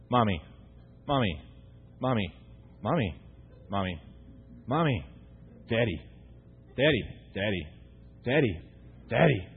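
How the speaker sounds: lightly voiced child pestering a parent; the same call on repeat, dropping in pitch each time; low-key, relentless kid nagging.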